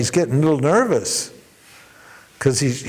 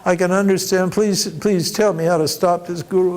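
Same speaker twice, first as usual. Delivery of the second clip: higher, pleading man; begging; 6 semitones higher than usual. Retold or imitated. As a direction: plead desperately for help. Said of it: "He begs him".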